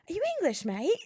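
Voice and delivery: high-pitched British bloke impression; British accent. British buddy approaching at the bar, British accent. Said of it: "in my British accent".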